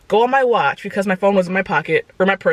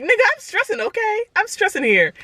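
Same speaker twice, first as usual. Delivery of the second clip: high, panicky protest; stressed panic; 12 semitones higher than usual. Retold or imitated. To the joking doctor, high panicked protest. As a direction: squeak out that you're stressing. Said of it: "and I'm like".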